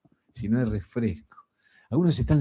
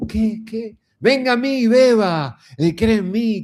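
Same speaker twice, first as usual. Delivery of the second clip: much higher, louder call to the crowd; a touch of Jesus's loud invitation; 11 semitones higher, 3 dB louder, slower than usual. Retold or imitated. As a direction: keep calling out to the people. Jesus calling the thirsty.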